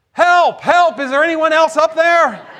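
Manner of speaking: loud, high holler; yelling for help. Acted. Jake rejects God's advice and seeks another rescuer, yelling. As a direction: shout up past God for anyone else. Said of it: "Finally, Jake yelled".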